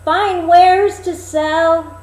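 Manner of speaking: put-on very high, loud, slow old peddler call; a disguised queen hawking like an old crone; peddler woman crying wares. Queen as old peddler calling at the dwarfs' door. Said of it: "knocked at the door and cried".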